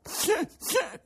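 a sharp high sob; high puppet sob. Acted. Pinocchio sobbing to Geppetto, a sharp high sob. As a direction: let a sob break out.

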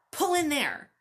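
loud order. A narrator's reading. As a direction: bark the direction at the driver. Ronaldo orders her to stop.

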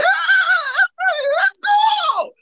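loud fired-up shout; excited let's go. Retold or imitated. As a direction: hype everyone up. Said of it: "he's like".

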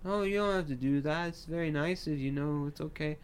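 politely demur in a thin, hesitant high voice; meek, polite young man; Michael Cera. Acted. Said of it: "Michael Cera's like".

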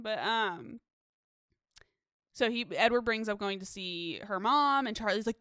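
ordinary delivery

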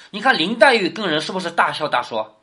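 usual speaking voice

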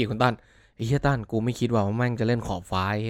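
low, soft aside to a friend; hushed retold aside. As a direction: re-enact a quiet, disbelieving nudge. (เล่าซ้ำแบบพูดเบาๆ ต่ำๆ กับเพื่อนอย่างไม่อยากเชื่อ)

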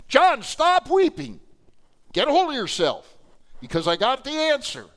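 elder loudly ordering John to stop weeping; firm call. Retold or imitated. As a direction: snap him out of his weeping. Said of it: "The elder says".

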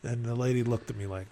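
ordinary talk

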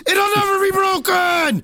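high-pitched excited shout. Real, not acted. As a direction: yell it in outrage, slowly. Arguing about football record.

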